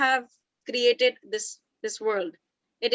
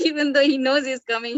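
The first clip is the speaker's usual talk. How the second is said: says it through a real laugh, a little louder; 3 semitones higher, 6 dB louder than usual. Real, not acted. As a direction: laugh it out at the joke. Laughing while teasing about husband.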